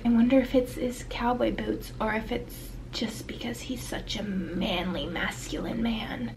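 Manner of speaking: whispery mock heroine voice; mock smitten heroine. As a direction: swoon in a whisper, mocking her. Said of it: "and she's like".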